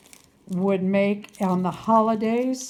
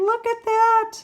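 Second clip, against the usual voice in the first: high, delighted squeal; excited exclamation; 12 semitones higher than usual. Real, not acted. Vlogger shows viewers a new treat, high excited squeal. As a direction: squeal it, much higher.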